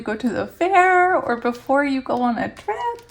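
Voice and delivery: high-pitched, bubbling anticipation; giddy excitement. Real, not acted. Reader describing excitement before Christmas, giddy. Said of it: "it's like that feeling of excitement".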